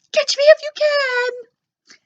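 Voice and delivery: very high, loud, slow taunt; high loud playful dog taunt; teasing dog. A narrator's reading. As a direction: tease the kids while running. Dog runs off with ball.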